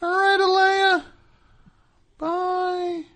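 high singsong farewell; singsong goodbye. Pretend. Singsong sign-off to caller.

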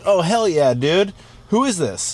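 high, keen voice of a clueless pal; high-pitched mock enthusiasm; Poopies. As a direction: agree eagerly, then ask obliviously. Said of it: "He's like".

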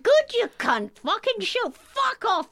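high, loud reenacted rant; retold angry yell. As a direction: yell it as if at the photographer. Retells yelling at the pap.

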